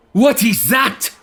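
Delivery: high alarmed call; man calling out. Acted. A man in the crowd to others, high alarmed call. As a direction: point and shout. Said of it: "he calls out".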